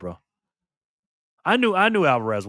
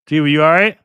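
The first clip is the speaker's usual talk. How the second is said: calling across the room; 4 semitones higher, 10 dB louder than usual. Real, not acted. Calling to someone nearby.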